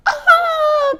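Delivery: high loud frightened scream; scared scream. Acted. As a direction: shriek in fright. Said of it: "cried the friends".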